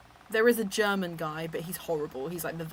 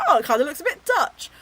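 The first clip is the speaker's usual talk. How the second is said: cheeky; 7 semitones higher, 9 dB louder, faster than usual. Retold or imitated. Retells own cheeky remark, amused.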